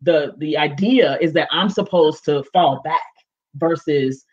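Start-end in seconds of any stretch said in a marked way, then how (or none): none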